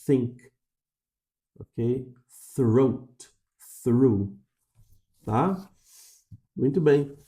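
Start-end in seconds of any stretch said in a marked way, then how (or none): 0.0-0.5 Think, tongue between teeth, air flowing; English th. Shown as a demonstration. Teacher models th for students. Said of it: "o som só sai se os lábios estiverem separados". 2.5-4.4 Throat and Through, tongue between teeth; th clear, lips apart; English th. Shown as a demonstration. Teacher models th words for students. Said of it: "A língua está entre os dentes".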